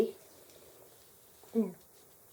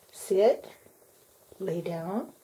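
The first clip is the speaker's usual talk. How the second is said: usual speaking voice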